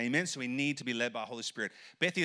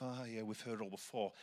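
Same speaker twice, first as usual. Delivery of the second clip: mumbled, dismissive mock teen; bored teenager; 3 semitones lower, 11 dB quieter than usual. Youth pastor mimicking jaded teenagers, quiet mumbled mock. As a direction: brush it off like a jaded kid.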